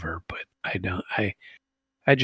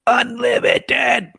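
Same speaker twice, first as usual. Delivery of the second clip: unlimited stretched out, much higher and louder; mock all caps; 8 dB louder, much slower than usual. Reading the president's post aloud, mocking its capital letters with a slow, loud stretched word. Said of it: "all capital".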